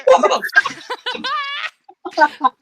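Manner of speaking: big loud burst of laughter; cracking up at the table. Real, not acted. GM laughing at the player's waddling character, loud burst.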